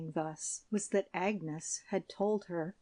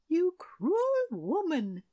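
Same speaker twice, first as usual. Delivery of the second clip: high, slow, tearful outcry; queen crying out; 8 semitones higher, 4 dB louder than usual. A narrator's reading. Queen crying out at the shepherdess, tearful. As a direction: cry it out in grief. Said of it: "cried the queen, and in her turn burst into tears".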